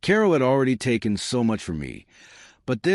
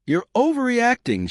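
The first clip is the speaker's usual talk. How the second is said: sharp high snap at her husband; snapping; 14 semitones higher, 3 dB louder than usual. A narrator's reading. Wife snapping back at her husband, sharp and high. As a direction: bite the line off, much higher. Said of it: "she snapped".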